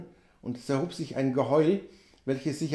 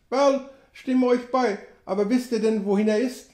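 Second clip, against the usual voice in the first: a higher scout agreeing; Sam voice; 6 semitones higher than usual. A narrator's reading. Sam asks the narrator where the killer went, higher. Sam's voice.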